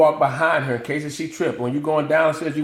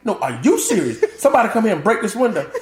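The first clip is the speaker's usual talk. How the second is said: laughing, fast and urgent; he warns about break-ins, fast and laughing; paranoid insistence; 6 semitones higher than usual. Retold or imitated. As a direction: warn them like danger is near. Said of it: "I'm like".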